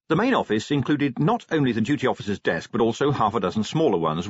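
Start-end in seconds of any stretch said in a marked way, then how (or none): none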